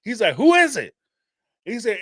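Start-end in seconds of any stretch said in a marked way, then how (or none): 0.4-0.9 grandfather calling out. Retold or imitated. Grandfather voiced in story.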